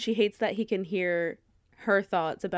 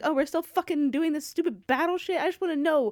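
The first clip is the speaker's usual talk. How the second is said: higher, whiny impatience; impatient reader; 5 semitones higher than usual. Retold or imitated. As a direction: whine to get to the answer. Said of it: "I'm like".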